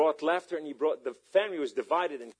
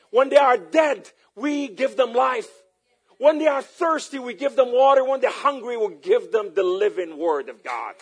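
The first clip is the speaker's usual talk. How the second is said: high, loud pulpit exhortation; ringing, raised sermon cry; full-force preaching; 9 semitones higher, 10 dB louder than usual. Acted. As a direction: preach it out to the whole congregation.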